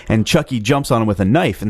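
normal talking voice

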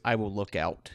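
low, soft, resigned voice; subdued in-character reply; Roscoe. Acted. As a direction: quietly take on the duty, pitched low. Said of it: "Roscoe sighs and goes".

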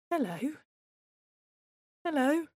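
put-on high little hello to a new baby; tender greeting. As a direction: coax a newborn gently, lifting each word. Parent greeting unresponsive newborn.

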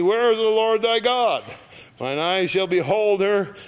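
high, loud declamatory Bible reading; scripture reading. Acted. Preacher reads verse ten to the congregation, loud and declamatory.

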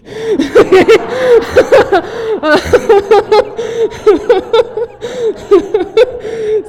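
high, loud, long laugh; real helpless laughter at your own joke. Real, not acted.

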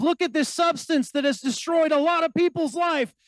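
high, loud, hammed-up enthusiasm; mock celebration. Preacher mimicking revelers celebrating drink, mock-excited. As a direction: imitate a reveler proudly cheering it on. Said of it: "there's kind of this celebration of".

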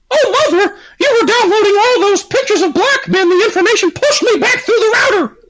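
high, laughing son's voice; mock-whiny kid caught out. [name] as son, high whiny voice.

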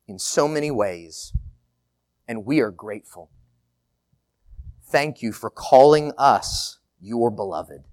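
earnest, steady prayer voice; spoken prayer. Acted. Pastor praying aloud for the congregation, earnest. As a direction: address God with gratitude, building on the repeated open.

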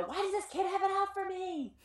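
exasperated. Retold or imitated. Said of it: "The next one".

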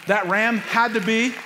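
high, loud and slowing; rising to the peak of the sermon; preacher's fervent climax; preaching build. Real, not acted.

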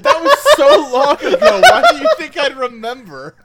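high loud laugh through words. Real, not acted. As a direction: laugh it off in disbelief. Laughing at forgotten episode.

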